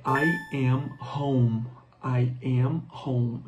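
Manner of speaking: "I am home" drawn out, very slow; very slow model phrase. Shown as a demonstration. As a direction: spell it out slowly for learners. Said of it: "Repitam comigo". (bem devagar, para repetir)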